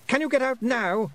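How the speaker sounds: put-on high, loud boy voice; urgent young boy; demanding boy. A narrator's reading. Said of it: "he demanded, his face red".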